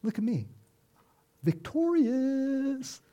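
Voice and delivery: high mocking showoff voice, dragging out Victorious; gloating taunt. Preacher mocks a gloating Jesus with a high taunt. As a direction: strut and gloat like a bully. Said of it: "I don't see Christ going down there and going".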